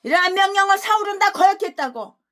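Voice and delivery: loud, high accusing cry; preaching shout. Real, not acted. Preacher shouting that Saul defied God's command. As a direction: hurl the accusation.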